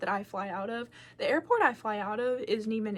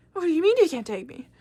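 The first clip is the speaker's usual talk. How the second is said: loud, higher-pitched protest; retold outburst, pitched up and louder; acted indignant disbelief; 5 semitones higher, 5 dB louder than usual. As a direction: throw the question back in outrage. Indignant repeat of question to mom.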